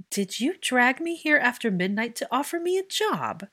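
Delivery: high, incredulous exasperated question. Acted. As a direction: let the exasperation drip from every syllable. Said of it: "Exasperation dripped from her every syllable".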